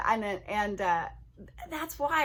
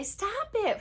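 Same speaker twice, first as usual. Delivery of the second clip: much higher, bratty snapping "Stop it"; whiny mock "Stop it"; 11 semitones higher than usual. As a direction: mimic a kid's bratty "Stop it". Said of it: "because if you say".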